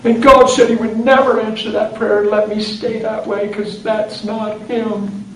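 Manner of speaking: high, slow imitation of weeping speech; drawn-out, high-pitched tearful voice; tearful confession. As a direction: keep up the wife's mock-crying wail. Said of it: "She said".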